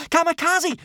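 very high, loud desperate cry; shriek. A narrator's reading. Boy crying out for dragged-under friend, shrieking. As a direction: shriek the name in alarm. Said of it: "shrieked Hiccup".

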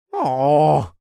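loud, high, drawn-out aww; adoring coo. Acted. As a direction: gush over an adorable baby animal. Melts at baby dragon's cuteness.

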